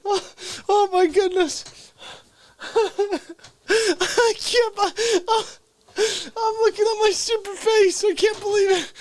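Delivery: high, laughing through the words; breaking up laughing. Real, not acted. Comic breaking character, laughing at himself. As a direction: lose it and laugh while talking. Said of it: "I'm breaking character twice tonight".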